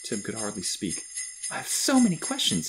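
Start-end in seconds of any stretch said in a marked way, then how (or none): 1.5-2.7 put-on high, eager child's voice; excited boy. A narrator's reading. Overwhelmed boy finally speaking to Santa, high and excited. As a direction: blurt it out high in wonder. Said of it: "he finally said".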